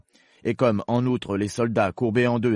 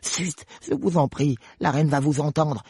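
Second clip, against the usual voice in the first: higher-pitched, fearful rabbit; fearful murmur; 6 semitones higher, 3 dB louder than usual. Acted. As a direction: hush her anxiously in a high voice. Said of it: "d'une voix craintive".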